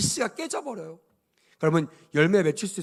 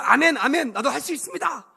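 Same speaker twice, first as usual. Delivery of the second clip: higher, keen churchgoer's response; mock eager amen; 7 semitones higher than usual. Churchgoer fired up during the sermon, mock eagerness. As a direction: play the instantly convinced believer.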